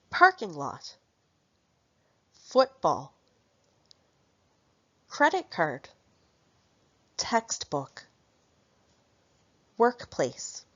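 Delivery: Parking, Foot, Credit, Text, Work stressed, second parts dropping away; stress on first word. Shown as a demonstration. Teacher drilling compound nouns, stress on first word. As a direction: hit the first half of each compound, as in Football. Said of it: "Compound nouns take stress on the first word".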